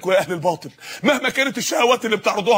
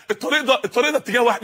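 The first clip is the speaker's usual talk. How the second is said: ordinary delivery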